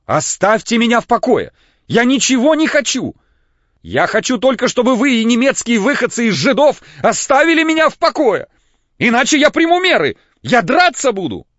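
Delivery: очень высоко и громко, срываясь; кричит, шатаясь от волнения; истеричный крик. A narrator's reading. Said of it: "уже не помнивший, какие он слова говорил". (истерический вопль, высоко и громко)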